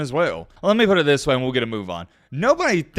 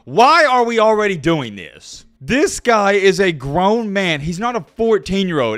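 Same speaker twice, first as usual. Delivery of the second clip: exasperated rant; 5 semitones higher, 4 dB louder, slower than usual. Real, not acted. Ranting at hype.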